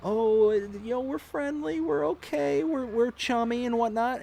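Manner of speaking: high sweet fake-friendly voice; voicing the sly snake, fake chummy; mock friendly snake. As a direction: coo like a false friend. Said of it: "lull you into this".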